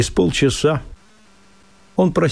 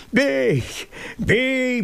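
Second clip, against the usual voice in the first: высокий свирепый вызов; бросай «бей» как вызов; свирепо; 13 semitones higher than usual. Acted. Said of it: "свирепым голосом". (мужик свирепо подставляется под удар)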